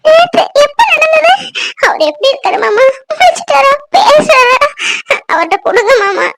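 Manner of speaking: high, tearful, stricken questions; tearful shock. Acted. Woman hearing from uncle of Vikram's accident, tearfully shocked. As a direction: choke out panicked questions through tears. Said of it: "சரிம்மா, அழாத".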